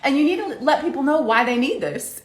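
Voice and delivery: high, loud insistent advice; imitating blunt friend. Friend coaching her on promotion, playful insistence. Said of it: "She's like".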